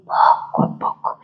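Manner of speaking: soft voiceless breath release; measured exhalation. Shown as a demonstration. Coach demonstrating dosed breathing, soft controlled exhale. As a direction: let the air out little by little, barely voiced. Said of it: "hacer una expulsión muy, muy dosificada".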